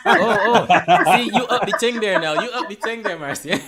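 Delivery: high, loud laughing teasing; loud laughing tease. Real, not acted. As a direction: crack up and tease her. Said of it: "we can have some fun this evening".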